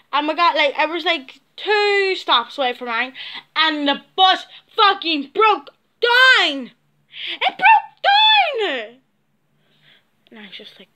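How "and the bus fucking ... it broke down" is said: slow, drawn out, rising in pitch; wailing that the bus broke down; exasperated disbelief. Real, not acted. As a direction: stretch it out in disbelief. Bus breaking down near home.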